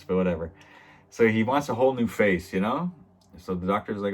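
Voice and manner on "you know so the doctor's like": usual speaking voice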